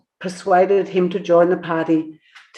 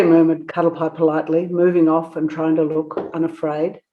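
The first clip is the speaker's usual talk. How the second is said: plain reading voice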